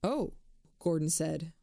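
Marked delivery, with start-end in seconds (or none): 0.0-0.3 louder. A narrator's reading.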